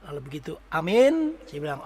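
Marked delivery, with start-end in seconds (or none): 0.7-1.3 loud amen. Retold or imitated. Ending a prayer aloud.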